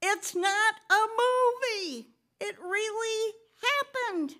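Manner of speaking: high, slow urgent yell; Daryl yelling. Retold or imitated. Youth minister on the phone insists the crash is real, high slow yell. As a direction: shout it so she finally believes. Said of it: "he literally yelled".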